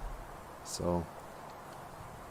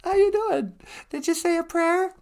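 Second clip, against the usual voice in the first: very high, squeaky greeting; high cartoon voice. Greeting the host, squeaky and very high. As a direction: chirp it like a little puppet.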